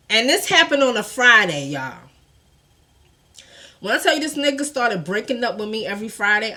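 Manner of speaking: usual speaking voice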